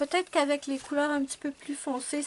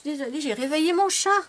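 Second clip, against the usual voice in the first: loud, sheepish exclamation; loud apology; 3 semitones higher, 9 dB louder, faster than usual. Real, not acted. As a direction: realise you woke the cat.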